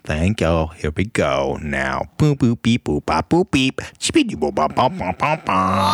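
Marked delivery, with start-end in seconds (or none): 2.2-5.9 computer processing. Pretend. Mouth noises before the prank call.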